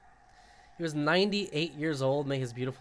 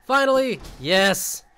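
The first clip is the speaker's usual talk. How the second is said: loud, high triumphant yell; real joy over the new move; excited cheer; 5 semitones higher, 9 dB louder, slower than usual. Real, not acted. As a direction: celebrate finally getting it. Gets long-wanted movement item.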